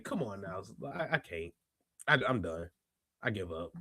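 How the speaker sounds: low, soft, giving up; defeated. Acted. Host giving up on her antics, defeated. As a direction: let it sink away as if beaten. Mock surrender after the worm.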